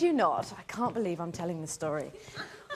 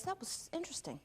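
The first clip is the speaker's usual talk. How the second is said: much softer, musing aside; understated actor's verdict; 12 dB quieter, faster than usual. Retold or imitated. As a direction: remark quietly, as if nothing odd happened. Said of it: "dusts himself off and goes".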